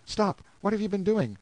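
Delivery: calling out. A narrator's reading. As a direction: call out sharply to halt someone. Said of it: "I called out".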